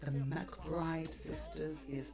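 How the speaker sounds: breathy whispered purr. Acted. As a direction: breathe it like a secret. Whispered ad line.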